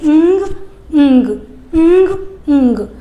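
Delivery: teacher drilling the ng sound aloud; practice drill. Shown as a demonstration. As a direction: drill ंग, g only half said. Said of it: "ग बोलना है पर पूरा नहीं बोलना है".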